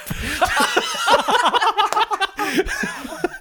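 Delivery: high burst of laughter. Real, not acted. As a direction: laugh out loud. Laughter after the punchline.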